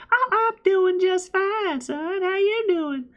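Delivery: put-on high, slow Southern old-timer greeting; slow, high Southern welcome; Southern accent. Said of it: "talk like this person".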